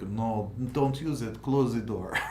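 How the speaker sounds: put-on Italian accent that slides into Russian; mock Russian accent. Imitating the company's Sicilian legal man, mock Russian accent. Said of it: "Vladimir Putin doing legal now".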